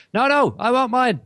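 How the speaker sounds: put-on loud, high-pitched childish protest; protesting woman. Woman refusing to share toys, loud mock protest. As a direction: imitate a grown-up demanding her toy. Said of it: "the woman over at the other place goes".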